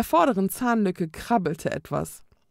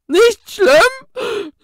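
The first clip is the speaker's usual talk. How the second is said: much higher and louder, outraged ghost; snorting, sobbing ghost; 12 dB louder than usual. A narrator's reading. Sobbing ghost snorts back at Daka, high and loud. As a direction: snort it out between sobs. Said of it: "schnaufte der Geist zwischen zwei Schluchzern".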